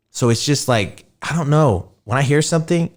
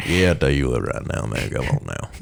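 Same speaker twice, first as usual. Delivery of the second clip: put-on old country boy; 10 semitones lower, 6 dB quieter than usual. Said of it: "old boys".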